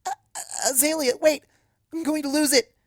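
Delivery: high, frantic alarmed cry; panicked cry. Acted. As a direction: cry out in a high panic before losing control. Twilight panics, about to lose magic.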